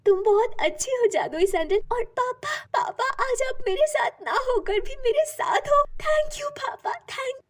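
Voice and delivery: very high, emotional thanks; high-pitched grateful daughter; overjoyed gratitude. A narrator's reading. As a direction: gush with gratitude to an absent father. Grateful girl thanks her father.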